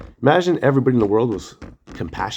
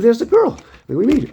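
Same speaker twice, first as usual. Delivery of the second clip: high, louder excited blurt; excited retelling; 12 semitones higher, 4 dB louder than usual. Retold or imitated. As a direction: rush it out, thrilled. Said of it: "I'm like".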